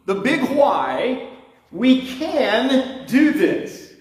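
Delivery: high, slow, fervent sermon delivery; preaching. Acted. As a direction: preach each phrase with building fervour. Preaching at full force.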